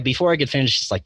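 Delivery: ordinary talk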